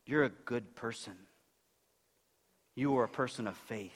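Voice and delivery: a quiet blessing from the master; soft approving master. Pretend. Master gently praising his faithful servant, soft and low. Hoped words of the returning master.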